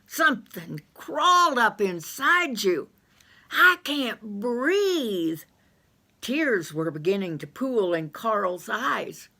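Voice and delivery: high voice, gasping for air; a high-voiced yokel choking on a stench; choking Carl. Carl's voice, choking.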